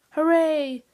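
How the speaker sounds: high, loud cheer; child's jubilant cry; joyful cry. A narrator's reading. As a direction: shout it out in triumph.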